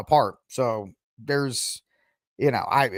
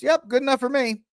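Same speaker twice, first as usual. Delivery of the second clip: higher-pitched mock agreement; mock easy acceptance; 9 semitones higher than usual. Host voicing everybody accepting the denial, mock agreeable. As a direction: chirp it as a crowd readily convinced.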